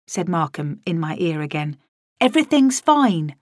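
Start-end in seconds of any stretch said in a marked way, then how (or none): 2.2-3.4 insistent reassurance. A narrator's reading. Markham insisting all is well.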